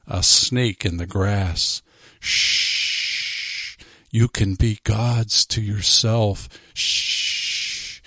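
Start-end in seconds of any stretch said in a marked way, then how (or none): 2.2-3.8 drawn-out serpent hiss; the preacher imitates a serpent hissing; snake hiss. Shown as a demonstration. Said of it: "to simulate the sound of a snake". 4.1-6.5 slow, coaxing serpent voice; tempting serpent. Pretend. 6.7-8.0 drawn-out serpent hiss; the preacher imitates a serpent hissing; snake hiss. Shown as a demonstration. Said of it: "to simulate the sound of a snake".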